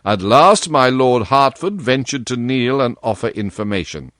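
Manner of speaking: ordinary delivery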